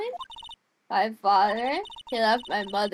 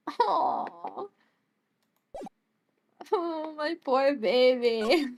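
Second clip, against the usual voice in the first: tender sympathy; 4 semitones higher, much slower than usual. Acted. Comforting crying Pearls.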